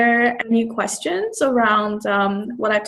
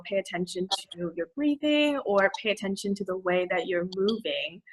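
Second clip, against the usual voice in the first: lightly voiced yoga instructor, softer; 7 dB quieter than usual. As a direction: gently imitate a yoga teacher's cue, quietly. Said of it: "the instructor will say things like".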